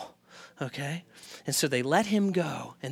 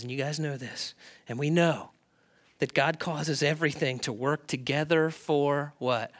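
usual speaking voice

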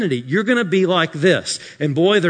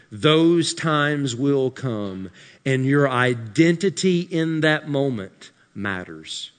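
ordinary talk, nothing marked

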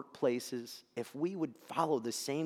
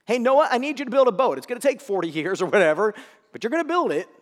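higher, louder commanding God voice; 7 semitones higher, 10 dB louder than usual. Pretend. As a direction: order Noah to build the boat.

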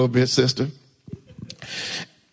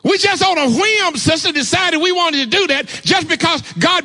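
higher, fired-up preacher calling on the church; preaching at full force; 10 semitones higher than usual. Acted. Preacher testifying to the church, fired-up preaching. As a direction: rouse the congregation, testify high and strong.

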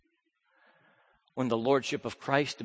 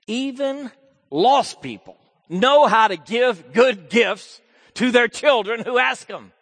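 loud, high preaching voice; full-force preaching; 12 semitones higher, 15 dB louder than usual. Acted. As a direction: preach it out to the whole room.

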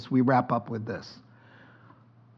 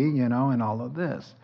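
ordinary talk, nothing marked

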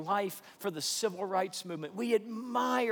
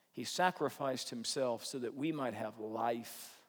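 usual speaking voice

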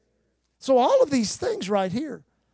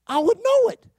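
very high, louder voice; emphatic retold answer; 12 semitones higher, 5 dB louder than usual. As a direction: mimic her insistent high-pitched reply. Retold woman answers the hammer question.

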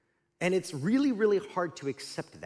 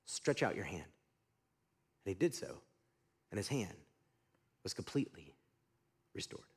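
much softer and lower, slowing to the miracle; hushed storytelling; 9 semitones lower, 9 dB quieter than usual. Acted. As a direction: let it drop almost to a whisper. Quiet climax of healing story.

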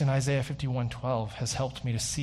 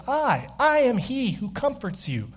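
high, stressed "I, I am"; emphatic; 8 semitones higher than usual. Pretend. God declaring himself to Israel, emphatic and high. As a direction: stress the repeated "I" hard. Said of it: "It's emphatic".

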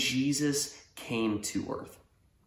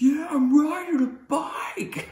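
high, drawn-out whoop; triumphant yell; 5 dB louder, slower than usual. Acted. Brother thrilled at riding a bike, high triumphant whoop. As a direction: holler it slowly in sheer joy. Rider thrilled on first ride.

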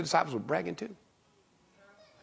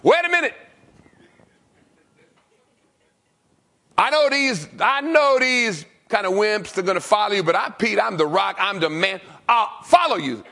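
boastful Peter; 8 semitones higher, 14 dB louder than usual. Acted. Preacher playing Peter boasting to Jesus, loud and high. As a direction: puff up like a cocky disciple.

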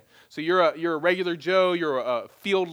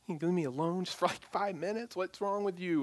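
softer, fast annoyed mutter; irritated brush-off; 8 dB quieter than usual. Retold or imitated. Pastor imitating himself shooing off unwanted callers, irritated. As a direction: wave off a caller who won't let you rest. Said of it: "like I respond sometimes when people call me".

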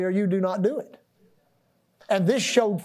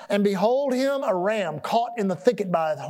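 usual speaking voice

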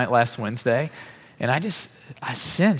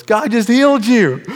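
much higher, louder and slow, voiced as the sister-in-law; jubilant declaration; 11 semitones higher, 9 dB louder, slower than usual. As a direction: proclaim it with joyful certainty. Sister-in-law announces healing.

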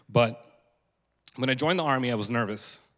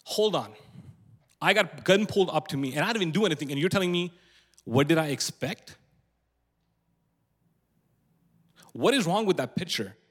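higher-pitched, incredulous pushback; indignant disbelief; 4 semitones higher than usual. Real, not acted. Speaker answering people who blamed him for being in Alabama, indignant. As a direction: challenge them, pitched up, drawing out the echoed question.

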